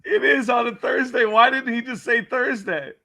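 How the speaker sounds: high, incredulous laughing voice. Real, not acted. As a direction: crack up while asking, voice pitched high. Cracking up at the clip.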